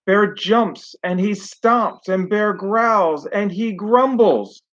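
put-on lively storybook read-aloud for kids; reading to children. A narrator's reading. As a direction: tell it like bedtime story to a class. Teacher reads picture book aloud.

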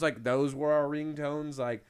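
usual speaking voice

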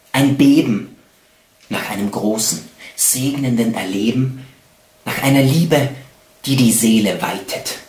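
slow, expressive poem reading with long pauses; poetry recitation. Acted. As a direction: recite love poetry, swelling and falling with each phrase. Recites love poems.

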